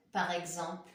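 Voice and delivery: normal talking voice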